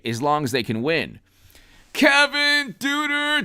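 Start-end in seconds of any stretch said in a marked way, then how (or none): 1.9-3.4 cheery shoutout. Real, not acted. Reading out a supporter's name.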